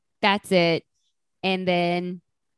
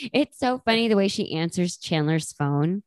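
normal talking voice

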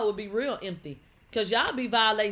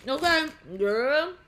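sassy; 6 semitones higher, 6 dB louder, slower than usual. Real, not acted. Sassy reaction.

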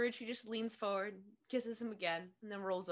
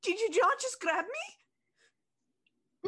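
slow, high, loud disbelief; drawn out in shock; startled accusation; 11 semitones higher, 5 dB louder, much slower than usual. Acted.

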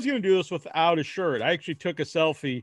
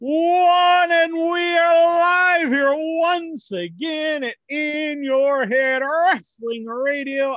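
loud, slow show-opening hype; announcer voice; 12 semitones higher, 10 dB louder, much slower than usual. Acted. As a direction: bellow the intro like a ring announcer. Host opens show, announcer style.

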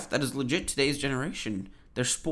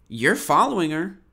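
loud; 6 semitones higher, 7 dB louder than usual. Real, not acted. Ranting at cancel culture fans.

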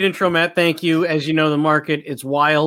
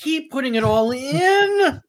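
whiny lament; 10 semitones higher, slower than usual. Acted. Trader lamenting losses.

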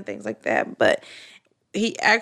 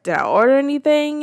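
higher, louder, quick puzzled question; mock-baffled, pitched up; puzzled retold question; 6 semitones higher, 5 dB louder, faster than usual. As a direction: blurt it out, confused. Retelling her surprised question to partner.

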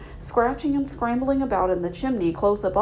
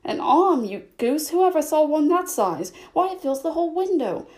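scolding; 7 semitones higher than usual. Acted. Rabbit scolding Pat, exasperated and high. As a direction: tell off the fool.

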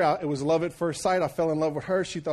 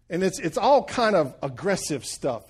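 ordinary talk

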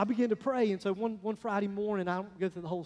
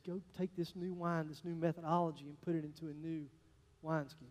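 gentle divine voice; 4 semitones lower, 8 dB quieter than usual. Retold or imitated. God's voice retold.

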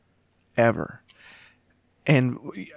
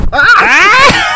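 normal talking voice

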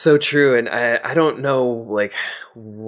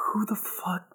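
shocked whisper; 9 semitones higher, 11 dB quieter than usual. Real, not acted.

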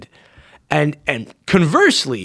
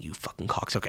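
seething whispered curse; fuming; 5 semitones lower, 14 dB quieter than usual. Retold or imitated. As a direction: whisper the insult with pent-up fury. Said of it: "I was fucking fuming".

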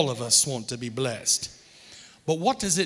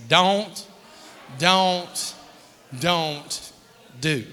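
ordinary talk

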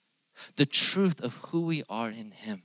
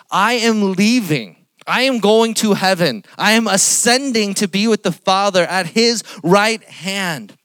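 proclaiming Jesus; 8 semitones higher, 12 dB louder than usual. Acted. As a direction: declare it like Jesus, with full preaching force. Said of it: "And he says".

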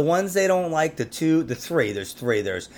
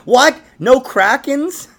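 much higher, louder, slowed "No Krakens?"; mock disbelief; 10 semitones higher, 9 dB louder, slower than usual. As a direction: play it like a scandalised courtier. Mock shock at Columbus report.

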